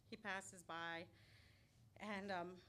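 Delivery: ordinary delivery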